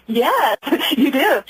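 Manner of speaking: slow, amused answer with a laugh; drawn out slowly, laughing on it. Real, not acted. Woman answering her panicked ex-husband, laughing slowly.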